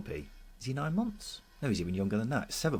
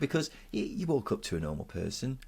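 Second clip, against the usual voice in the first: normal talking voice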